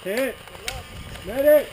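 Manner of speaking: shouted call for medic. Real, not acted. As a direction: yell it across the field. Airsoft game, calling for medic.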